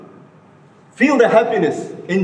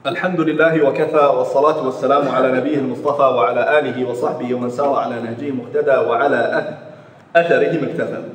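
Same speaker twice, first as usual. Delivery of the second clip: fast, lower recited praise formula; rapid liturgical Arabic, lower pitch; formulaic Arabic opening; 8 semitones lower, much faster than usual. Real, not acted. Opening formula of second khutbah.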